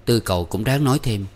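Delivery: ordinary delivery, nothing marked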